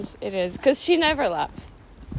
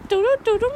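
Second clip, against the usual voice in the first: high honking doo-doo-doo-doo; clown horn impression; 9 semitones higher than usual. Shown as a demonstration. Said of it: "the clown horn for the car".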